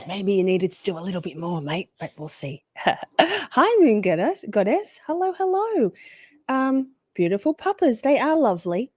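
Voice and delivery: ordinary delivery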